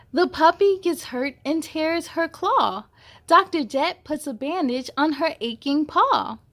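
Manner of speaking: plain reading voice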